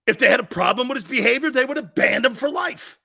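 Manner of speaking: loud, high, fast rebuttal; heated argument. Real, not acted. Host rebuts critics of Deshaun Watson, heated. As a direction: drive the point home loud and fast.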